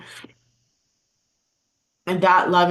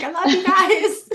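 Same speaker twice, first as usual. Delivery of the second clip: gushing quote; 11 semitones higher, 4 dB louder than usual. Retold or imitated. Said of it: "I'm like".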